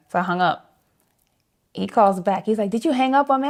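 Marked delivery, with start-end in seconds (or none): 2.7-3.5 higher-pitched mock indignation; retold disbelief, pitched up; indignant question. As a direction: ask it high, as if affronted. Retold indignant callback from bandleader.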